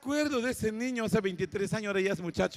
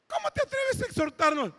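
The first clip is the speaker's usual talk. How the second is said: ancianos reclamando; 10 semitones higher than usual. Retold or imitated. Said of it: "me decían".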